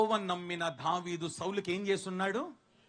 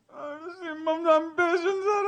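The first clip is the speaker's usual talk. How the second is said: mock fake crying; 7 semitones higher, 7 dB louder than usual. Brothers fake-crying to Joseph.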